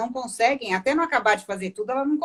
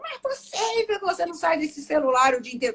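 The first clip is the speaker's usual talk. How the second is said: exasperated wife; 6 semitones higher than usual. Acted. Wife scolds husband on his phone, exasperated. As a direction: imitate a fed-up wife complaining in a raised pitch. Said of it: "ela fica possessa com o outro".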